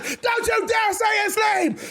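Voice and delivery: high, yelled furious warning; losing his mind. Acted. Seth yells at Damien for saying the name, enraged. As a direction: snap with raw fury. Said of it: "Seth Rollins loses his mind".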